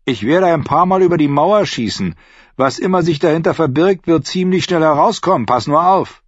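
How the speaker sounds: high, loud, boastful threat; aggressive shout. A narrator's reading. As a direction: bark it over the wall. Archer threatens to shoot.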